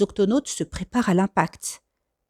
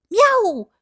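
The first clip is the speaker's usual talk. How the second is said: very high, louder cat meow; playful meow; 12 semitones higher, 4 dB louder than usual. Pretend. Kwasi spooking the crew, sudden high meow. Imitating ghost pirate surprise.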